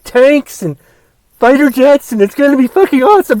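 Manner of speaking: lightly mock the braggart president; mocking Trump. Host mimicking Trump bragging about his parade, mild mock voice. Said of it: "He's like".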